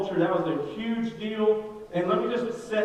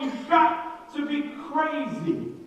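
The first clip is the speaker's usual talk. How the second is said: put-on a pleading old man, pitched up; exasperated Abraham; 8 semitones higher than usual. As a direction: play Abraham baffled at the promise. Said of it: "I'm sure Abraham is going".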